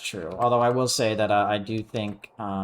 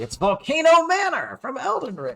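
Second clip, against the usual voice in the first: high, rising cry of recognition; hammed-up excited reveal; excited outburst; 5 dB louder than usual. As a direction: blurt it out high in mock amazement. Mock-dramatic recognition of the place.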